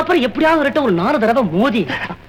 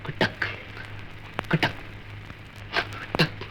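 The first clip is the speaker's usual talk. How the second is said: very soft "kattak" clicks, evenly spaced; imitated footstep sound; 8 semitones lower, 15 dB quieter, slower than usual. Son mimicking footsteps in horror story, hushed clacks. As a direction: tap out eerie footsteps with the mouth. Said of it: "அந்த சத்தம்".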